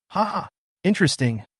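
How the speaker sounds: light laugh into the word; chuckle, then say it gently. A narrator's reading. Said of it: "He laughed too".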